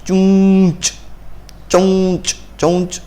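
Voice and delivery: a deliberate, nasalised चौंच sound; pronunciation demo. Shown as a demonstration. Teacher showing the ञ sound in चौंच, deliberate and nasal. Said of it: "ञ का उच्चारण कैसे होता है?".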